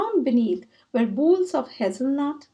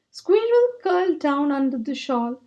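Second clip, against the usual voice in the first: ordinary delivery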